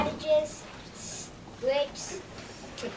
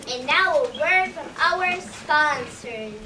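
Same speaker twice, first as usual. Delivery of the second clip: slow, louder announcer read; 6 dB louder, slower than usual. Acted. Anchor throwing to a commercial break, slow and loud.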